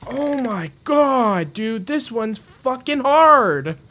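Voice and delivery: high, loud frustrated yelling at the fish; real rage. Real, not acted. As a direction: scream at the game in real fury. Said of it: "I'm so mad right now".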